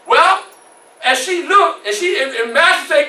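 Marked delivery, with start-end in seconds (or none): none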